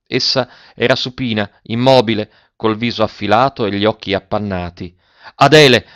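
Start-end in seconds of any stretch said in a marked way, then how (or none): none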